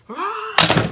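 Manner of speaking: high yelp, like a loud hiccup; sudden yelp. Acted. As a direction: blurt a sharp high cry. Mock spoiler-hiccup outburst. (petit cri aigu soudain)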